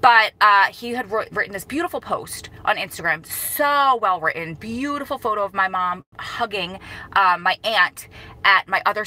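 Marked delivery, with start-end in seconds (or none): none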